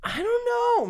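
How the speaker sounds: squeaky, drawn-out doubt; high doubtful. Real, not acted. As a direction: pitch the doubt way up. High-pitched doubting in debate.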